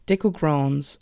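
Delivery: usual speaking voice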